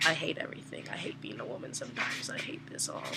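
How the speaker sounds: grumbling misery under the breath; despairing mutter. Retold or imitated. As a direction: sink into muttered self-pity. Muttered despair after humiliation.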